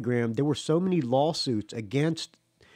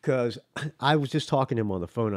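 ordinary delivery

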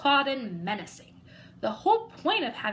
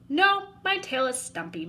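slurping; 4 semitones higher, 3 dB louder than usual. Acted. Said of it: "Mac slurped".

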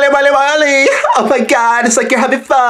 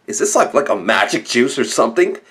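much lower and softer voice; changed voice; 11 semitones lower, 5 dB quieter than usual. Acted. Asking about the juice in a much lower, softer voice. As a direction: sink the voice way down in surprise. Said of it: "really changing my voice".

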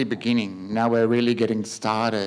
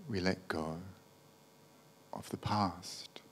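guided meditation; 3 semitones lower, 5 dB quieter than usual. Acted. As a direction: gently guide them into letting go, unhurried.

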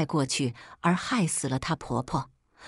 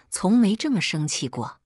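ordinary delivery, nothing marked

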